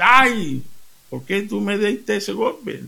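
a hint of a struck husband crying out; mock pained husband. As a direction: yelp like you just got hit. Husband hit by wife in joke.

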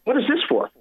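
sudden high-pitched puzzled question; surprised clerk. Retold or imitated. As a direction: she can't believe a stranger tipped her. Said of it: "she goes".

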